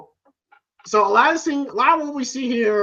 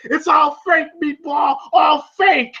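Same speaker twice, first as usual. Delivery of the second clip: raised, higher-pitched taunting yell; mock wrestler shout; 6 semitones higher than usual. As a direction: holler it like a hammed-up wrestler. Imitating wrestler taunting Rocky.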